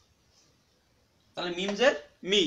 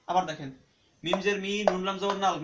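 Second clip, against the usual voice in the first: ordinary talk, nothing marked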